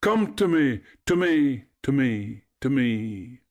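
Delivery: high call dying away; shouting, fading echo. A narrator's reading. Echo repeats Narcissus's words, shout fading away. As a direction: repeat like an echo, dropping each time. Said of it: "shouted Echo".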